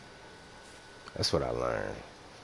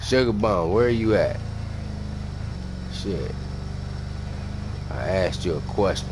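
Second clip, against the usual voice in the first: higher, louder demand for an answer; loud impatient callout; 5 semitones higher, 7 dB louder than usual. Pretend. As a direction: shout at Sugar Bum to answer now. Calling out to chat member.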